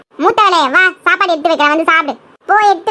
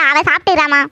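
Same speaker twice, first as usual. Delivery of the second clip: usual speaking voice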